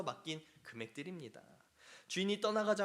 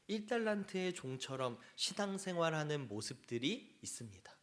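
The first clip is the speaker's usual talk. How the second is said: ordinary talk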